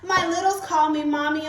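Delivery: ordinary talk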